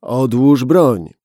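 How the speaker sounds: slow, loud "Odłóż broń", stress on "broń". Acted. Gunman repeating his order with hard determination, slow and loud. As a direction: spell it out, final warning. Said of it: "z jeszcze większą determinacją w głosie".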